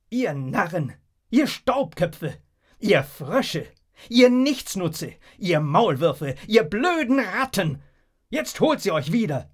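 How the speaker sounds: loud volley of name-calling; jeering insults. A narrator's reading. Leader abusing the shoeless crowd, loud jeering.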